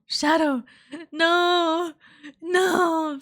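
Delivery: loud alarmed shouts; alarmed cry. Real, not acted. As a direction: cry out in game panic. Game character falls.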